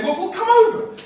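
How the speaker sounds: high, loud, eager invitation; bursting to have him round; excited invitation. Acted. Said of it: "hear the excitement in Jim's voice".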